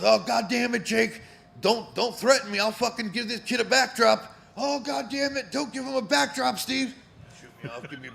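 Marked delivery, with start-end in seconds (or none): 0.0-7.0 high, loud hammed-up yelling at Jake; roaring mock argument mid-match; mock shouting wrestler. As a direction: ham it up like a wrestler barking in the corner. Acting Steve Austin yelling in ring.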